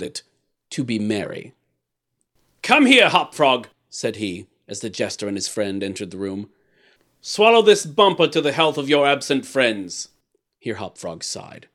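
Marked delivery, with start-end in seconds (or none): 2.6-3.7 loud, higher, slow summons; commanding king. A narrator's reading. King ordering his jester over, commanding. 7.2-10.1 a touch of lordly command, raised voice; commanding king. A narrator's reading. King making the jester drink, mildly commanding.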